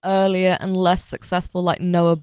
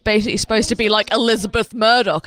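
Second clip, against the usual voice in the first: ordinary talk, nothing marked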